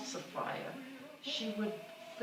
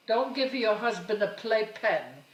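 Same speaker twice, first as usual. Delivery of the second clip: put-on mother's accented voice; 4 semitones higher, 7 dB louder than usual. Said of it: "And she would tell me".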